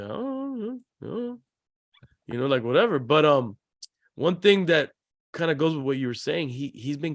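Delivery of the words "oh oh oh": imitating sheepish son. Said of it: "looks at you like".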